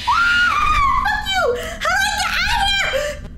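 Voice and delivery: high terrified screaming; scared horror game streamer. Real, not acted. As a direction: scream in fright.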